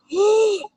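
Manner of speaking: sharp high loud gasp of shock. Retold or imitated. Clerk gasping on hearing her religion. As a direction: gasp as if caught out. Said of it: "And he goes".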